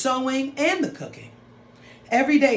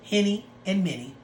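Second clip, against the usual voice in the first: ordinary delivery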